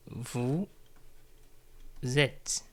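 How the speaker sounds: "Vous", a long pause, then "êtes"; word by word. Shown as a demonstration. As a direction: split "Vous êtes" into two separate words for learners. Teacher repeats phrase word by word.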